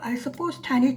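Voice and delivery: ordinary delivery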